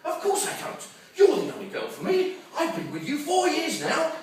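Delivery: high-pitched mock-innocent reassurance; acted sweet denial, pitch climbing; protesting boyfriend. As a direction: plead innocence to your girlfriend, hammed up. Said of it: "you say".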